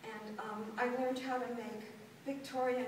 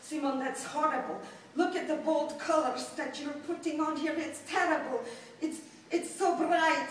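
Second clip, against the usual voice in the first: louder and higher mock scolding father, with a laugh; 4 semitones higher, 7 dB louder than usual. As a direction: play the father running down her drawing, half laughing. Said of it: "And my father said".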